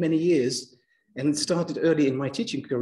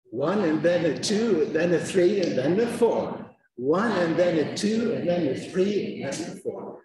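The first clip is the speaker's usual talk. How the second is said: even beats on the numbers; rhythmic stress-timed count; stress timing beat; 2 semitones higher than usual. Shown as a demonstration. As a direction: keep the same beat, cram the unstressed words. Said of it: "it's called stress timing".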